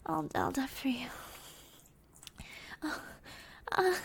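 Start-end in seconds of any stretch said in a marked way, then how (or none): none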